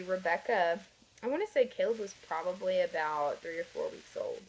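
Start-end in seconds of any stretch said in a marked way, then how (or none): none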